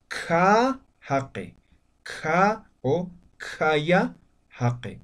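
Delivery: "ka jaque" dicho muy despacio, sílaba por sílaba; pronunciación lenta. Shown as a demonstration. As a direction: alarga "ka" y "jaque" para que lo oigan. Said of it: "Yo digo". (el profesor de aimara pronuncia "ka jaque" muy lento)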